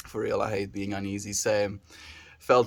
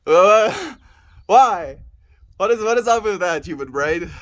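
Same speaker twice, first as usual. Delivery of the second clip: exasperated outcry; 9 semitones higher, 10 dB louder than usual. Acted. Scolding own brain after joke.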